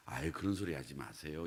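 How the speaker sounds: soft, low polite brush-off; a mild, understated brush-off; mild gentle protest. Shown as a demonstration. Children softly brushing off a parent's words, low and quiet. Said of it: "이게 아니라".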